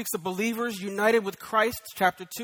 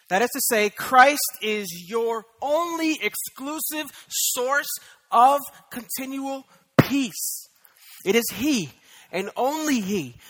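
much slower, higher and louder; slow emphatic preaching; 5 semitones higher, 8 dB louder, slower than usual. Acted. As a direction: lean on every word like a pulpit climax.

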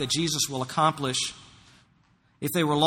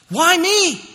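wondering exclaim; 13 semitones higher, 8 dB louder than usual. Acted.

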